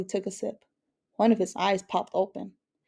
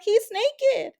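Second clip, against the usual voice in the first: much higher shocked cry; cried out; 14 semitones higher, 4 dB louder than usual. A narrator's reading. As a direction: squeal it in sheer surprise. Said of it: "Abuela looked up from the mower and cried".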